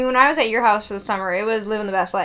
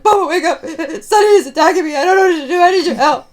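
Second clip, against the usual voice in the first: laughing panicked plea; 5 semitones higher, 5 dB louder, faster than usual. Retold or imitated. Pleading with grandpa.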